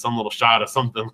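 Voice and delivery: usual speaking voice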